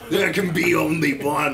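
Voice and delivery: loud, hammed-up warrior voice; mock-epic decree. Warrior to rival Greenlander, mock-epic decree. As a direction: proclaim it like a film hero. Parodies a film line.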